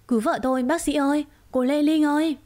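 calling for help. Acted. Husband calls doctors for help.

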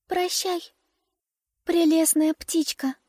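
put-on high, gentle little girl; sweet tiny girl saying farewell. A narrator's reading. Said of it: "сказала дюймовочка".

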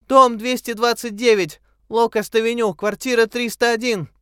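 high, loud call from far away; a reply calling across the water; faint distant call. A narrator's reading. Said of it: "Слабый, еле слышный ответ". (далёкий крик)

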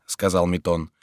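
ordinary delivery

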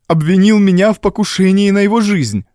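high, urgent woman's outburst; distressed accusation. A narrator's reading. Wife tells the doctor of the accusation, high and distressed.

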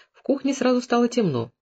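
usual speaking voice

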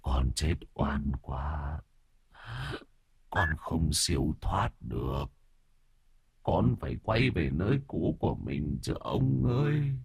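soft, slow wronged ghost; ghostly wail. Acted. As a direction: plead like a restless ghost. Ghost pleads with master.

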